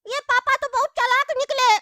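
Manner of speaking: put-on high child's voice, scheming and hungry; a kid talking to himself about food. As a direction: play a greedy little kid plotting. Child character voice throughout.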